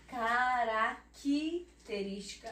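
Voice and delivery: característica very slow, "ca-ra-qui-te-rística"; "Característica" with qui as its own syllable; mute C sounded. Shown as a demonstration. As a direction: split Característica and linger on "qui". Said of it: "Aqui já tem um som de Q".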